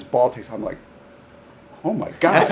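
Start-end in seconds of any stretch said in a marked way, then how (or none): 1.8-2.5 loud, high astonishment; retold gasp of disbelief, voice raised; amazed reaction. Coach watching a kid's surprise shot, amazed.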